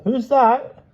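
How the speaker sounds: high, loud suspicious call through the door; a wary voice behind the door. A narrator's reading. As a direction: call out warily. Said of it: "A suspicious voice called".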